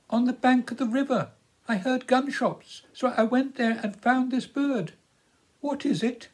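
put-on high, anxious girl's voice; a shy girl holding out a hurt bird; young girl voice. A narrator's reading. Young girl pleading with Philip, anxious high voice.

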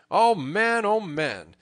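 high, loud, amused exclamation; mirthful cry. A narrator's reading. Robin to thrashed Little John, overcome with mirth. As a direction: exclaim through your mirth. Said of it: "as well as he could for his mirth".

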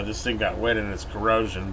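normal talking voice

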